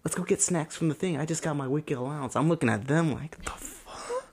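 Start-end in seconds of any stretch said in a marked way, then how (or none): 0.0-2.4 lightly voiced kid, higher pitch; slightly higher mock friend voice; imitating school friends. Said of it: "my friends would talk about". 3.4-4.3 high, thin, incredulous reaction; squeaky hammed-up confusion; mock bafflement. His baffled reaction to friends' allowances.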